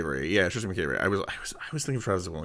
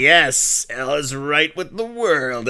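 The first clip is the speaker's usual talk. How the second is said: mock-triumphant cry, louder and higher, then drawn out; Lawrence Keane; 5 semitones higher, 7 dB louder, much slower than usual. Host imitating a very happy character at his big moment, hammed-up exultation. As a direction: play a villain exulting as his plan succeeds. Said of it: "Lawrence Keane is just like".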